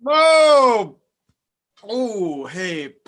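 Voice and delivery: loud high gasp of wonder; sudden high loud oh; startled cry. Acted. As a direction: jolt awake dazzled. Lysander wakes enchanted.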